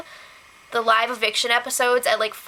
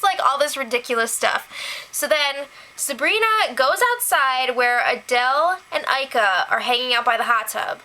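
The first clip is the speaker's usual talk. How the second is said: ordinary talk, nothing marked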